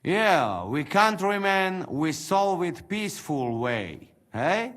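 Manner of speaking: put-on broken English in a Hungarian accent; Hungarian-accented fellow countryman persuading. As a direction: coax him in a thick Hungarian accent. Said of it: "that accent".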